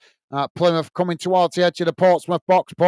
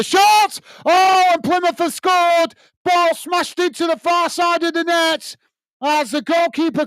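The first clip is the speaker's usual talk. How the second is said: much higher, elated goal call drawn out; real thrill as the home side scores; excited goal call; 11 semitones higher than usual. Real, not acted. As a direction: scream the goal home like a commentator. Goal scored in live commentary.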